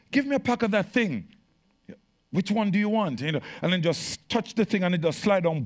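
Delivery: high, loud, driving preacher voice; fiery preaching. Acted. Pastor warning the church about energy drinks, fiery preaching. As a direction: preach it hard to the congregation.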